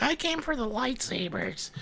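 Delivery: high, soft put-on fan voice; mocking fan imitation. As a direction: mimic a fan in a high little voice. Mimicking a chat member's reply.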